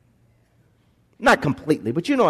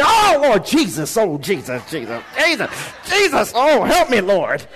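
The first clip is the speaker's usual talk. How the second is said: mock pained cry. Preacher acting out hurting his finger, crying to Jesus. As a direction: cry out like you just hit your finger.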